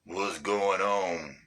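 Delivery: very low, slow drawn-out greeting. Acted. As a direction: drop way down and stretch out the words.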